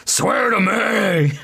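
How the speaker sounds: slow, loud high yelled "Swear to me"; Batman shout. Retold or imitated. Batman demanding an oath, slow hammed-up shout. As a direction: bellow the demand. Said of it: "he goes".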